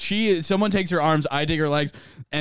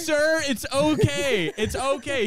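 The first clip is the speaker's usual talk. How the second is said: high, drawn-out placating voice; reassuring staff; 9 semitones higher, slower than usual. Retold or imitated. Staff to driver with broken window, slow mock reassurance. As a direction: imitate placating an upset man.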